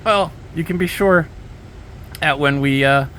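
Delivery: ordinary talk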